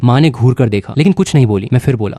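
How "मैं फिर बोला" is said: ordinary delivery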